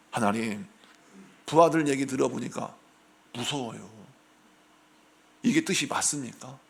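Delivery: mildly frightened whisper to God; quietly scared prayer, understated; timid fearful prayer. Retold or imitated. David's fearful prayer voiced.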